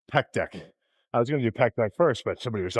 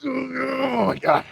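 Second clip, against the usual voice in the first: exhausted groan after lifting; 13 semitones higher, much slower than usual. Real, not acted. After brutal rep, groaning.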